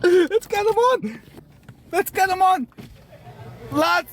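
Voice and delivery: high, eager call; excited egging-on. Real, not acted. Egging others on amid laughter.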